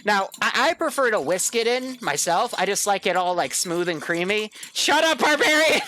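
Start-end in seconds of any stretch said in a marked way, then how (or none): 4.7-5.8 higher, louder shut up; shouting mock anger. Real, not acted. Host yelling at cohost Barbarian over his jokes, mock-angry shout. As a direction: yell it at the joker, half laughing.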